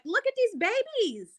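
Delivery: high, slow, choked-up aww; tender, close to tears over the kids; choked-up tenderness. Retold or imitated. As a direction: coo over the babies as if tearing up. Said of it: "I was getting choked up".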